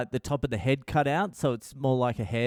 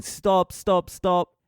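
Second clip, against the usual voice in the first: mock screaming; 5 semitones higher, much slower than usual. Two older kids beg a toddler to stop spinning, mock screaming. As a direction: imitate kids' screams, higher pitch only.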